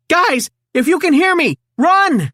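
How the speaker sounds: high, loud frantic warning; frantic shout. A narrator's reading. Donatello losing contact with the robot, frantic high shout. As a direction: yell a desperate warning. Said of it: "he shouted".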